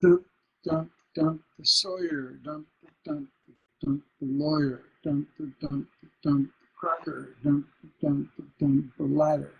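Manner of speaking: rhythmic list, "Sawyer" and "lawyer" stressed on first syllables; relentless beat with weak final syllables; feminine endings. Shown as a demonstration. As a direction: pound out "dump", let "cracker" and "ladder" fall off. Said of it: "All those are feminine endings".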